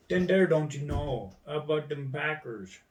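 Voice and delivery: loud, exaggerated Fargo accent. Shown as a demonstration. Showing off an American accent, loud Fargo sing-song. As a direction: lay on a thick Fargo accent. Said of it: "the fucking Fargo was offensive".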